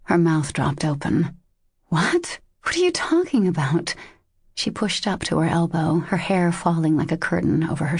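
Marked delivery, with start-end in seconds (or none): 1.9-4.0 shocked. A narrator's reading. Shocked at his accusation.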